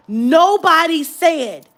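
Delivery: exasperated slow holler; indignant outburst. Real, not acted. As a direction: stretch it out in outrage. Indignant at being ignored by staff.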